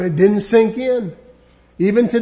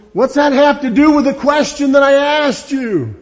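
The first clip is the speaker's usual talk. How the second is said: louder, higher exasperated question; exasperated Pilate; 5 semitones higher, 5 dB louder than usual. Acted. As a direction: demand it like a frustrated governor. Preacher voices confused Pilate.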